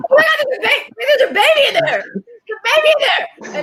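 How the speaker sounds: very high, shrieky retold panic; mock panic. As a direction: squeal in comic alarm at a sudden realization. Retold panic at forgotten baby.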